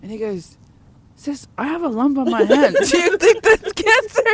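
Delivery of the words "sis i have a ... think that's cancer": high, loud, squeaky mock of the cousin. Voicing cousin's silly question.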